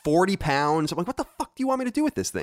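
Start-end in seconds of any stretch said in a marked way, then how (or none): 1.1-2.4 exasperated. Retold or imitated. Exasperated quote at huge turkey.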